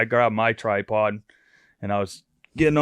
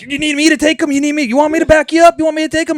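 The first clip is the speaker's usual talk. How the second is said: loud, very high-pitched frantic offers; Jim's excited voice; 10 dB louder, faster than usual. Retold or imitated. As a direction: mimic an over-eager buddy pestering at full pitch. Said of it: "So excited".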